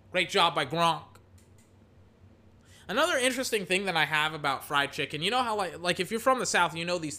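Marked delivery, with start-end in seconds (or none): 0.1-1.0 sportscaster call that rises to a big shout on the catch; hyped live football commentary; play-by-play call. Acted. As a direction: call the play like a TV announcer, peaking on the name. Mock play-by-play of the game.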